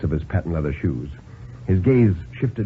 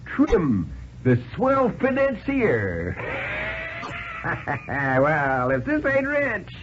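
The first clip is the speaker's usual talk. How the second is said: much higher, cackling hobo, delighted at his luck; gleeful tramp; 12 semitones higher, 4 dB quieter, slower than usual. A narrator's reading. Tramp gloating to the banker over his luck, high and cackling. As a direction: crow over finding a rich man.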